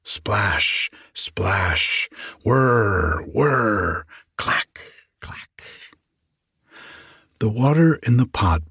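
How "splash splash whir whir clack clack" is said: drawn-out whirs, crisp clacks; imitated work noises. A narrator's reading. Fairies washing, spinning and weaving wool, imitated in spoken sound words. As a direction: voice each noise of the busy kitchen. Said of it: "the noise they made".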